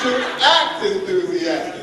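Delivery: slow chant broken by laughter; laughing demo. Shown as a demonstration. Leading the group in the chant, laughing. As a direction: laugh through the line as you lead it. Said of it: "so let's try it".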